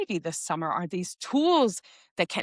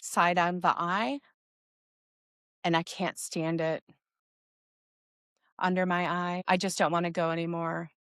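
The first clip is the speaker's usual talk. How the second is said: guided tapping sequence. Shown as a demonstration. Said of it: "I will say the location as we go through the tapping sequence".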